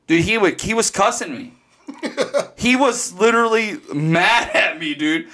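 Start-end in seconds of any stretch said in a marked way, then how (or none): none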